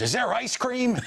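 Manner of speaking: high-pitched mock eager question; imitated outburst. Retold sudden cry from Shapiro.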